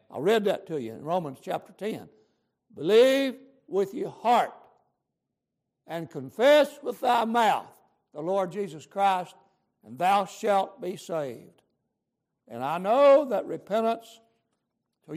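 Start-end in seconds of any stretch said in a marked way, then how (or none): 2.7-11.6 slow, loud preaching of scripture; preaching at full force. Acted. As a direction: proclaim it slowly to the congregation.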